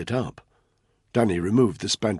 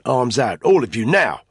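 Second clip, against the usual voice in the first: forceful command, louder and higher; barked order; 5 semitones higher, 7 dB louder than usual. Acted. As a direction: order them about, loud and urgent. Intruder orders terrified family.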